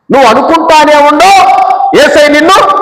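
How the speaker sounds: high, loud, rising preacher's call; fervent preaching. Acted. Pastor rousing the congregation with a loud, high call. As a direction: lift the church with a ringing cry.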